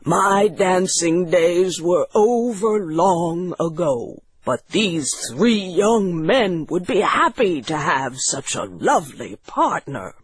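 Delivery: lightly voiced doctor, restrained; quiet answer. Doctor declining the widow's dance, restrained quiet answer. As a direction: decline gently in an understated character voice. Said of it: "answered the doctor quietly".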